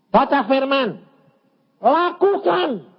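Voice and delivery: high, forceful proclamation; preached command. Retold or imitated. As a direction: proclaim it high, stretching the final word. (seruan khotbah tinggi dan tegas)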